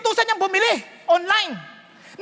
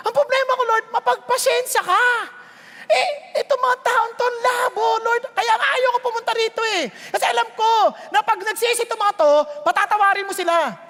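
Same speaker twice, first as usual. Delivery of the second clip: whining Jonah; 6 semitones higher than usual. Retold or imitated. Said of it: "Galit siya".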